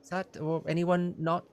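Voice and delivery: high, eager innkeeper voice; fussy innkeeper. Acted. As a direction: play a flustered, helpful innkeeper pitched high. Innkeeper offers drinks.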